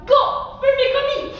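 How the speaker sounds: high, loud command and shout; martial stomp call. Shown as a demonstration. Teacher and children kicking with other leg, shouted. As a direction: fire off the cue, then the kick cry. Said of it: "Quand je dis go, tu dis Fumikomi".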